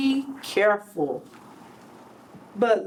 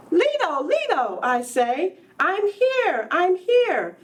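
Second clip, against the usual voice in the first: put-on higher, joyful boy calling out; excited calling; 6 semitones higher than usual. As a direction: call grandpa at the door, thrilled. Child calls out to grandfather.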